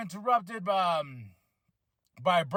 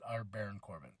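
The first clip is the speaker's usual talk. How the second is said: low, much softer deadpan; deadpan disbelief; 9 semitones lower, 16 dB quieter than usual. Retold or imitated. Fan seeing Corbin unchanged, soft deadpan. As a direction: mutter it, totally unimpressed. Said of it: "I'm like".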